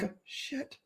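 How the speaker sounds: tiny whispered curse; internal melting. Retold or imitated. Speaker doesn't recognise who called her, whispered inner panic. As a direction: whisper it, privately sinking. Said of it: "internally I'm melting because I'm like".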